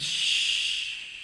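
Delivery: drawn-out "shh"; long shushing. Acted. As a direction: hush a weeping crowd. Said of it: "the Levites, like".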